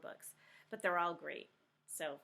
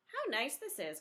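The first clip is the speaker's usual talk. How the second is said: delighted cry; 7 semitones higher, 5 dB louder than usual. Acted. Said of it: "cried Jessie".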